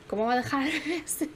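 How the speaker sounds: incredulous question breaking into a laugh; can't believe it, laughing. Real, not acted. Laughing at the mother leaving the baby. Laughs at absurd game situation.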